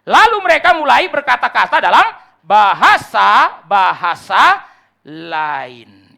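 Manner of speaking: loud, ringing scripture read; preaching at full force. Acted. Preacher reads Acts aloud to the church, booming. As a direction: proclaim the verse to the congregation.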